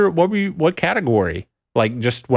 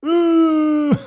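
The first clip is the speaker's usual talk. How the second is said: pouting face. Pretend. Streamer showing chat a fake pout, high mock whine. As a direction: whine it out like a sulking kid. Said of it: "Let's see your best pouting face".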